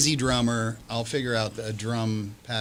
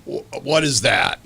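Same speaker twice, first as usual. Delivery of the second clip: from another room; 4 dB louder, slower than usual. Retold or imitated. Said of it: "I'll hear from the other room".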